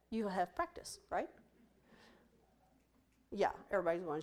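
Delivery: ordinary talk, nothing marked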